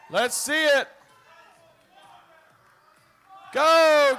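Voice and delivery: loud arena announcer shouting; hype announcer. Acted. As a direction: pump up the crowd for the lift. Announces lifter, cheers him on.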